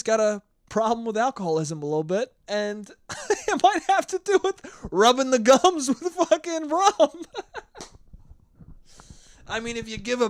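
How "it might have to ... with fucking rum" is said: high giggling words; laughing. Real, not acted. Laughing at own story.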